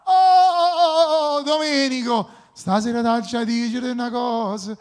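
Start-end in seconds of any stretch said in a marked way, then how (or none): 0.0-2.3 high, loud delighted greeting; excited. Real, not acted. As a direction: cry out with joy at the caller. Host greets caller live on air.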